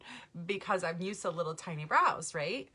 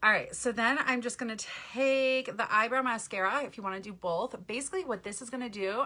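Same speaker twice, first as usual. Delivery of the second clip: ordinary talk, nothing marked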